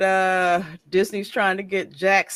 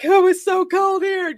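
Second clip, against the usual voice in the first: whiny mock complaint; 11 semitones higher, 4 dB louder than usual.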